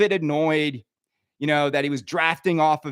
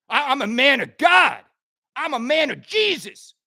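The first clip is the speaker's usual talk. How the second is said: indignant shout; 7 semitones higher than usual. Real, not acted. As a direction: proclaim it like a man at the pulpit. Protesting his own righteousness.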